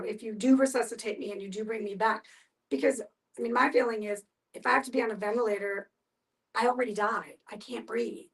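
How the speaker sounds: normal talking voice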